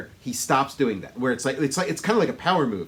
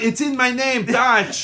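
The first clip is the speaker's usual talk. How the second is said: higher, louder mock Austrian accent; Schwarzenegger; 9 semitones higher, 6 dB louder than usual. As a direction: play it big with a comic Austrian accent. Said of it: "he talks the way he does".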